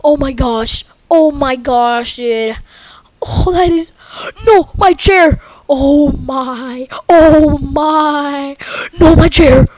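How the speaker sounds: loud, high wail, building in panic; dismayed wailing. Real, not acted. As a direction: cry out as it all falls apart. Losing chair and boat in game.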